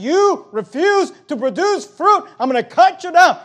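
loud, high, each word drawn out and punched; thundering divine rebuke. Acted. God to Old Testament Israel, thundering rebuke.

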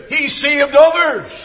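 high, loud pulpit cry; retold derisive cry. Preacher voices the jeering crowd at the cross, loud cry. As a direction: call it out high, like a crowd's jeer. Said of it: "that cry of derision".

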